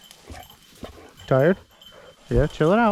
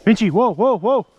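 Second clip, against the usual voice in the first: calling whoa; 5 semitones higher, 5 dB louder than usual. Real, not acted. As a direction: call the dog back, rising on each whoa. Calling dog to stop.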